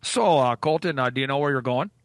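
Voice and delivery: a hint of a fussing Minnesotan; Minnesotan host. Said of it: "they'll go".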